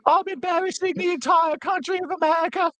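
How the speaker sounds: very high, put-on British lady; British woman voice. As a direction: squeak it out like a posh dame.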